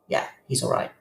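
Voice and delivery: a quiet private reassurance; soft echo. Retold or imitated. Echoed reassurance, voice dropped.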